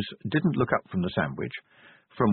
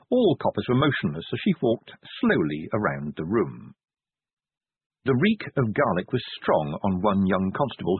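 ordinary delivery, nothing marked

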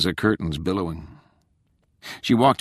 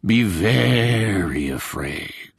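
slow, stretched mock menace; hammed-up spooky warning; ghostly moan; 2 semitones higher, much slower than usual. A narrator's reading. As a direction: moan it out like a stagey phantom. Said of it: "the voice from the closet moaned".

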